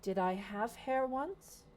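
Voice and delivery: slow, lightly voiced giantess; a slow, puzzled giant; lightly voiced giantess rubbing her bald head, slow and puzzled. Giant voiced by game master.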